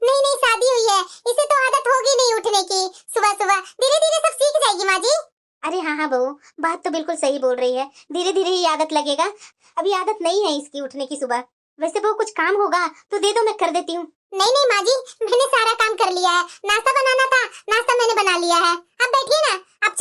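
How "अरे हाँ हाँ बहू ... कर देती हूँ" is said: put-on lower, older voice, mild and agreeable; an old mother-in-law nodding along; elderly mother-in-law. Mother-in-law agreeing with elder daughter-in-law, lower older voice.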